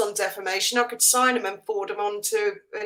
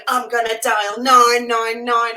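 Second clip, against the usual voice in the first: mock threat; 7 dB louder, much slower than usual. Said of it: "people to this day going".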